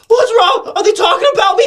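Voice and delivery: high, loud imitation of a paranoid friend; mock paranoid. Tripping friend overhears the others talking, mock paranoid.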